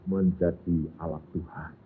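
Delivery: normal talking voice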